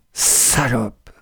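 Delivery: a soft, contemptuous insult spat out; low bitter spit of an insult. A narrator's reading. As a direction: spit the word out in disgust. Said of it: "cracha-t-il".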